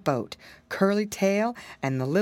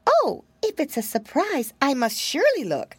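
put-on high, fretful little girl voice; a tiny frightened mouse wailing; small scared mouse girl, pitched up high; timid mousy girl; 7 semitones higher than usual. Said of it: "said the mousy girl".

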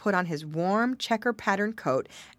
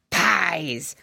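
greedy dog voice; 6 dB louder than usual. A narrator's reading. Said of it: "cried the bad egg dog".

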